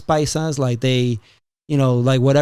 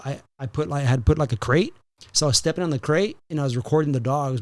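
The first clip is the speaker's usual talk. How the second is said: ordinary talk, nothing marked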